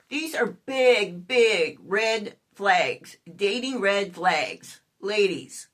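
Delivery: very slow, every word stretched; slow, weighty emphasis; emphatic warning. Real, not acted. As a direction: spell out the warning word by word, drawn out. Drawn-out warning to viewers.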